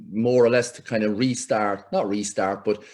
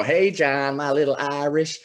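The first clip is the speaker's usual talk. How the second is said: slow, higher, cooing women's voice; 5 semitones higher, slower than usual. Retold or imitated. The American women cooing over him, slow and higher. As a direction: croon it fondly, lightly. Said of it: "all the women would go".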